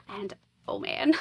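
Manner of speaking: ordinary talk, nothing marked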